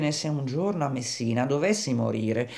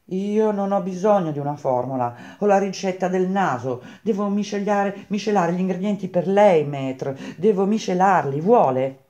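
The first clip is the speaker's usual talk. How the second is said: higher-pitched, eager apprentice voice; croaking Grenouille, insistent; 6 semitones higher than usual. Acted. Grenouille boasting of his nose to Baldini, insistent. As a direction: press the point in a raised, excitable voice.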